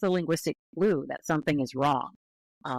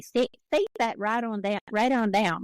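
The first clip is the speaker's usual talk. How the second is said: higher-pitched Southern drawl; 6 semitones higher than usual. Shown as a demonstration. Linguist imitating the woman's pronunciation, Southern drawl. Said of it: "imitate the pronunciations, but sort of the Southern drawl".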